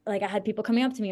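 usual speaking voice

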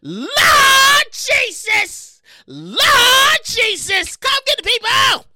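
high, loud church-style wail; hammed-up shouted lament; mock wailing plea. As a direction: cry out to heaven, stretching the first words. Mock plea to heaven over ban.

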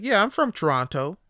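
mocking an outsider who claims the city. Imitating an outsider bragging, hammed-up. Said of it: "pronouncing the T's way too heavy".

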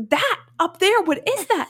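high-pitched acted panic; alarmed. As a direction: point out the danger urgently. His retold panic, pointing at corner.

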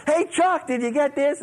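high, loud, breezy hail; imitated bold call-out. Girlfriend walking right up to the preacher, imitated bold call-out. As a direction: call out brashly, imitating her.

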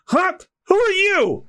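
high, startled character voice; startled challenge. Acted. Pirate demanding who the stranger is, startled.

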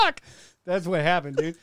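normal talking voice

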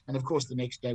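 usual speaking voice

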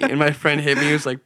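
ordinary talk, nothing marked

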